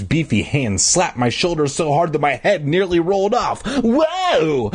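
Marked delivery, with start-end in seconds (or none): 3.8-4.7 high startled yelp. A narrator's reading. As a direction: yelp it out in alarm. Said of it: "I yelped".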